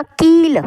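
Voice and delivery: कील with a drawn-out ee; long vowel. Shown as a demonstration. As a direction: hold the ee in कील. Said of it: "देर तक आवाज़ दे रहे हैं".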